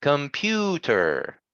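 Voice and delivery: "Computer" very slow with a drawn-out oo; stress on PU. Shown as a demonstration. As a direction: hold the middle of "Computer" long, then fall. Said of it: "We stress the oo".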